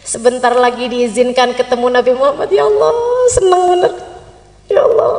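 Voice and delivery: low devotional musing; moved gratitude. Acted. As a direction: marvel gratefully to yourself, unhurried. Pilgrim's inner prayer while circling.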